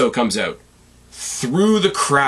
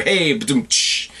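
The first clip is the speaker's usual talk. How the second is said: mock rimshot; much slower than usual. Rimshot after his own pun.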